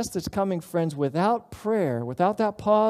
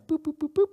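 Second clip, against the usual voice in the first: imitated phone beeps; 9 semitones higher, faster than usual. Mimicking phone dialing beeps.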